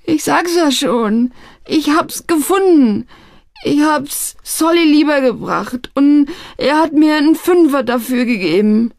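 put-on whimpering young man protesting his innocence; wailing. A narrator's reading. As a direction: plead in a pitiful high wail. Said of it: "jammerte er".

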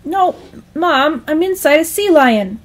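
high, pleading correction; whiny, frustrated protest; exasperated son. Acted. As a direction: insist to an uncomprehending mom.

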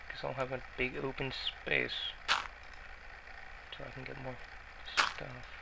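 half to oneself, softly; mumbling. Real, not acted. Gamer thinking aloud to viewers while building, mumbling. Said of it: "Sorry guys if I'm mumbling".

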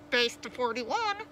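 slow radio call-out; crackling radio dispatcher. A narrator's reading. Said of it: "crackled the dispatcher's voice".

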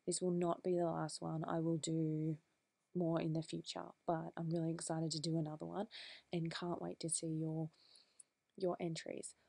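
ordinary delivery

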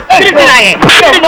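sharp yell after them; shout at thieves. Acted. Hero yelling at the fleeing thieves, shout. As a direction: bellow at the thieves.

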